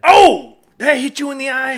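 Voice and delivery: high, loud startled cry; mock shock. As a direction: yelp like you saw a miss. Reacting to fake spit.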